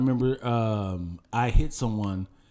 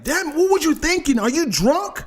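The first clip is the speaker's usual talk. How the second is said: angry accusing; 6 semitones higher than usual. Acted. Other driver voiced.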